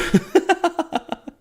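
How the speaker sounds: high, staccato wheezy laugh; amused cackle. Real, not acted. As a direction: crack up at your own joke. Laughs at own mock wail.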